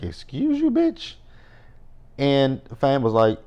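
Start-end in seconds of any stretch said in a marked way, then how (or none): 0.0-1.1 very high, indignant "excuse you"; sassy outrage. Retold or imitated. Snapping back at a rude character, very high and outraged. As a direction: shriek the insult up high. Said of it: "I was like".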